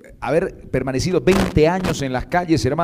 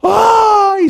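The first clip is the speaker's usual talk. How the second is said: a sudden high loud cry of dismay; high shriek; 7 dB louder than usual. Acted. The nun shrieks on hearing she is pregnant, a high cry.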